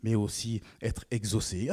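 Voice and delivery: usual speaking voice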